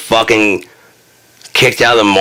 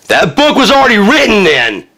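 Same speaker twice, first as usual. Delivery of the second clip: much higher, louder outburst; exclaiming punchline; 13 semitones higher, 4 dB louder than usual. Real, not acted. Mocking Christians settling near Babylon, loud exclamation. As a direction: blurt it out in disbelief.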